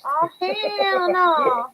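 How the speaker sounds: slow, drawn-out sassy "Oh, hell no"; sassy quote. Retold or imitated. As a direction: stretch it out like a sitcom diva. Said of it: "Where he starts, like".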